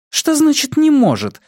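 очень высокий возмущённый женский голос; возмутись резко выше обычного; возмущение. A narrator's reading. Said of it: "возмутилась женщина". (возмущённо, на высокой ноте)

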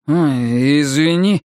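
mildly cowed, hesitant sorry; squeezed-out apology. A narrator's reading. Bobby apologises to angry Buddy, forcing it out. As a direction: force out a small apology. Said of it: "выдавил Бобби".

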